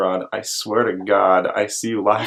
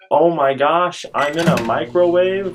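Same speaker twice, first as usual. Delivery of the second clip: generic excited voice; 5 semitones higher, 3 dB louder than usual. Retold or imitated. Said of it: "the generic me going".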